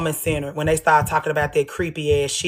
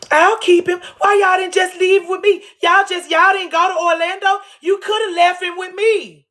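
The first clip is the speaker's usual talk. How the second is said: much higher, pleading and rising to a shout; mock eager babysitter; 11 semitones higher than usual. Mocking a woman begging to babysit her son, high and overeager. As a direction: beg to keep the child, overeager.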